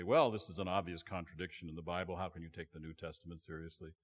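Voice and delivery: quiet, quick, dismissive academic voice; mock scholar. Preacher mocking Ivy League scholars, quick and quietly dismissive. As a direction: brush it off like a smug scholar. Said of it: "scholars ... would say".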